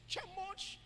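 normal talking voice

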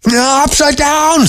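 very high, drawn-out weasel screech. A narrator's reading. Weasel whose eye is glued wrong, high screech. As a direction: squeal it slowly in alarm. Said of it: "screeched Weasel".

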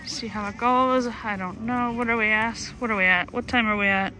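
ordinary talk

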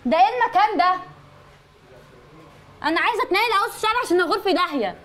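fed-up complaint. Real, not acted. Customer wants out of the salon, exasperated.

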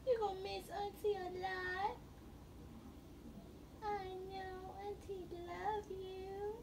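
high, slow cooing to a newborn. Real, not acted. Aunt cooing to baby.

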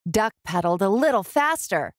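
ordinary delivery

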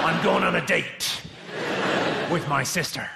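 West Country pirate growl; slow hammy pirate. Shown as a demonstration. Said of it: "just a pirate voice".

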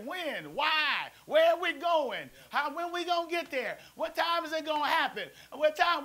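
put-on nagging questions like a restless kid; mild whiny child asking when; pestering kid. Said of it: "we used to always ask our parents".

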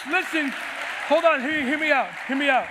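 loud, urgent preacher's call; preaching. Real, not acted. Pastor pleading with his congregation, loud and urgent. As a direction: grab the crowd's attention.

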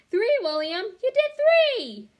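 very high, loud, slow squeal of joy; ecstatic cheer. A narrator's reading. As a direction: squeal it out in delight. Child cheering classmate's pull-ups.

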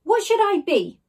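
high, loud eager question; Slime eager. A narrator's reading. Slime asking Ned what to become, high and eager. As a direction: ask it keen to help. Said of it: "asked Slime".

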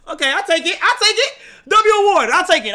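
high, loud happy acceptance; excited accepting the award. Real, not acted. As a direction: take it with a big grin.

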